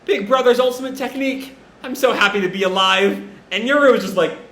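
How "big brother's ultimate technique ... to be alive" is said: higher-pitched, lightly gushing admiration; mock awe. Sister admiring her brother's attack, lightly acted awe. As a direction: gush a little over a big brother. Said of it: "Asa is just in awe".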